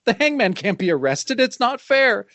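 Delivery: high-pitched petulant protest; hammed-up indignant whine; whining. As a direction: freak out like a thwarted villain, whining. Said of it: "he's just whining".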